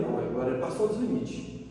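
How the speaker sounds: low, subdued realization; retold reaction. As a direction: say it quietly, as if to yourself. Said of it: "eu olhei e falei". (reação contida, em voz baixa)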